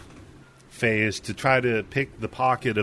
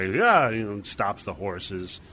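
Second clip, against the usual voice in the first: ordinary talk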